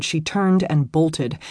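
usual speaking voice

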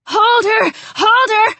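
high, loud, frantic command; urgent shout. A narrator's reading. Alex orders Sharon to hold Ruby down, shouting urgently. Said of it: "Alex shouted".

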